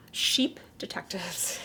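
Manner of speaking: whispered title, slow and secretive; hushed mock-dramatic reveal. Announcing the film title.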